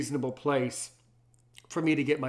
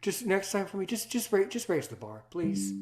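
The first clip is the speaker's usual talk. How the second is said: corporate manager voice; 5 semitones higher than usual. Pretend. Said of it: "Like that. That's how they talk".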